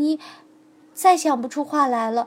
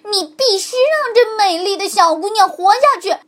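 raised urgent demand; shouting; 7 semitones higher, 9 dB louder than usual. A narrator's reading. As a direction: call out for the girl's life. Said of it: "骨头大叫". (骨头大声要求狐狸放人)

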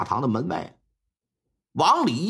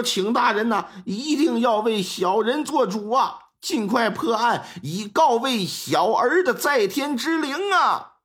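a hint of tearful pleading, slowing at the end; crying plea; 5 semitones higher than usual. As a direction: beg the magistrate through mock tears. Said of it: "哭了，". (略带哭腔的哀求)

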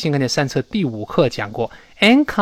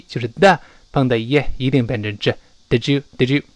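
normal talking voice

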